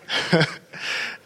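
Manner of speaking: a short airy chuckle; quiet amused laugh; soft breathy laugh. Real, not acted. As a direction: laugh lightly under the breath. Laughing at his own story.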